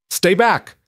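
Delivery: high, sharp call over the chaos; cutting command. A narrator's reading. James halting the rushing townsmen, cutting command. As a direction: raise the voice to halt everyone. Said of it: "voice cut through the chaos".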